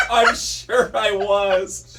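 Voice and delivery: talking through laughter; laughing. Real, not acted. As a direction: laugh the words out slowly. Laughing after being teased.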